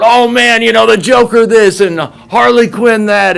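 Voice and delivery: high, loud gushing; imitated crowd buzzing loudly; excited fan chatter. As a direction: babble like a thrilled fan. Retold excited chatter of fans.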